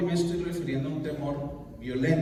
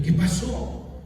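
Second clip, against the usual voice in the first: higher-pitched anxious question; worried; 5 semitones higher, 4 dB louder, much slower than usual. Retold or imitated. Husband still asking what happened, worried. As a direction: re-enact pressing for the news. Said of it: "Yo estaba preocupado".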